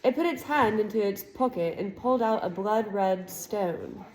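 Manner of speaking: usual speaking voice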